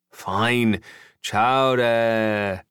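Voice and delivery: grudging, drawn-out giving in; reluctant compliance. A narrator's reading. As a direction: give in and echo it half-heartedly, stretched out. Jason gives in grudgingly.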